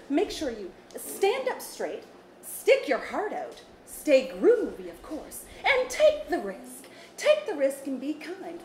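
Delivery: put-on slow, encouraging kids' host; cheerful preachy advice to children; Auntie B voice. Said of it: "In your Auntie B voice?".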